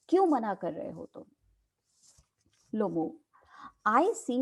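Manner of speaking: normal talking voice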